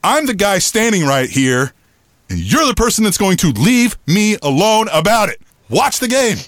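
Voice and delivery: retold angry comeback, rising in pitch; getting mad. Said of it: "I'm, like, getting mad".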